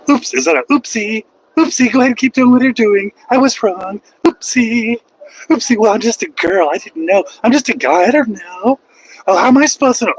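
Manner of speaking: high, sing-song fake innocence; mocking an excuse with fake naivety; mock innocent. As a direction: play dumb in a high silly voice.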